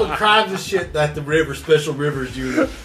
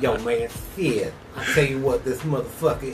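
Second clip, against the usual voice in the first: [name]; 3 semitones lower, 5 dB quieter than usual. Acted. Snapping turtle talks to the river, [name] impression. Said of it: "talks like [name]".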